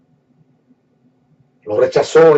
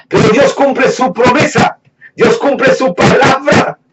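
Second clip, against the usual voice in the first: higher, slower scripture proclaimed with fire; fervent preaching; 7 semitones higher than usual. Acted. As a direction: preach the promise to the room. Preaching scripture at full force. (predicación ferviente, aguda y lenta)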